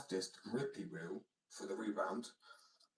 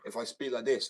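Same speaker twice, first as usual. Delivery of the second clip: raised, louder projected voice; added pitch; 3 semitones higher, 7 dB louder than usual. Shown as a demonstration. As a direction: lift the voice so people can hear. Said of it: "I add this sort of pitch".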